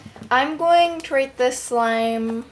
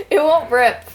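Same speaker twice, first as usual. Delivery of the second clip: laughing, a little higher; 4 semitones higher, 5 dB louder than usual. Real, not acted. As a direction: giggle through the words. Slime refuses to rip.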